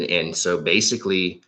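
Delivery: ordinary talk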